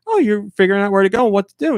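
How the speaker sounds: high, fast and bright encouraging; cheerful. Retold or imitated. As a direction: sound upbeat and encouraging. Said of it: "I'm like".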